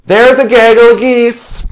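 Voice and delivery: high, loud, slow shout of the phrase; shouting to friends who spot geese, gleeful yell. Shown as a demonstration. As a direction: call it out to the whole trail. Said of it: "please yell out".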